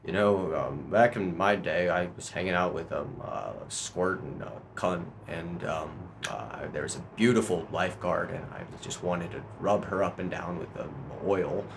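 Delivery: soft, low, hesitant rambling; Joe Biden impression. Comic as Biden reminiscing about a lifeguard, low halting mumble. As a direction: ramble quietly with stumbling fillers. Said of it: "Joe Biden".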